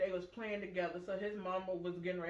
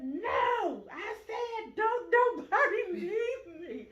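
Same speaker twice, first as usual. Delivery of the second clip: put-on high, loud toddler wail breaking into laughter; mock screaming tantrum; 13 semitones higher, 7 dB louder, much slower than usual. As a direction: insist in a hammed-up child's wail.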